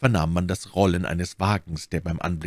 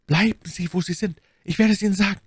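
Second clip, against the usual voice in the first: high, hurried order; urgent command; 12 semitones higher, faster than usual. A narrator's reading. Milady to Bonacieux as horses approach, urgent command. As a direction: order them to stay put, high and quick.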